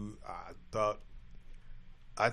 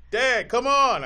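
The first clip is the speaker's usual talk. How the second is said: put-on high, loud crying call of a hurt kid; son calling out; 11 semitones higher, 11 dB louder, much slower than usual. Father imitating son calling from the ground, high and loud. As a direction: play the boy wailing for his dad.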